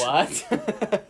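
high, loud mock outrage breaking into laughter; mock shock. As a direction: act scandalised, half laughing. Joins mock shock about Santa.

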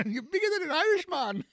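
high-pitched mock Irish lilt; Irish accent. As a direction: put on a jokey, high Irish brogue. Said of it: "Can you do an Irish accent".

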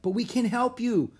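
put-on high, loud, eager child; keen small kid's voice; little girl calling. A narrator's reading. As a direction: plead eagerly like a six-year-old. Small girl calling after runners.

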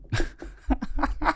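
a sudden high airy burst of laughter. Real, not acted. Laughing at dad's phrase.

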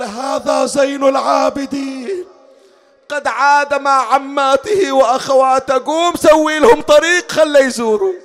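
high, loud, slow declamation; impassioned announcement. Acted. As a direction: proclaim the news in a ringing, drawn-out cry. Boy announces the mourners' return.

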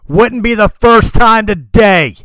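high, loud heroic retort; loud, cocky comeback; defiant shout. Acted. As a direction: yell back fearlessly at the dragon. Farron defies the dragon.

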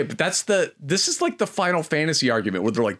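ordinary talk

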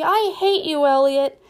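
high, loud furious yell; little girl shrieking in rage; screaming child. A narrator's reading. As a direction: scream it at your brother. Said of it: "screamed Gertie".

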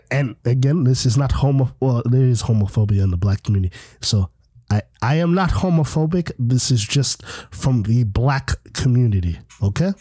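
low, assertive bass; deep, forceful explaining voice. Real, not acted. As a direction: drop low and lay down the point firmly. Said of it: "I put bass in my voice".

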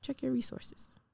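soft, near-whispered public notice; whispery side note to the listeners; hushed disclaimer. Shown as a demonstration. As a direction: slip in a quiet disclaimer. Mock disclaimer after medication talk.

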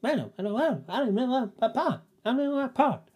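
very high-pitched, garbled mumble to herself; mumbling woman. Retold or imitated. Stranger talking to herself in a parking lot, high mumbling. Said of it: "like, mumbling something".

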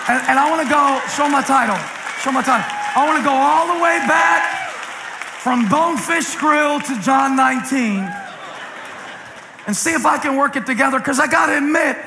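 high, ringing sermon delivery; full-force preacher cadence; preaching. Acted. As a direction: build it up for the congregation.

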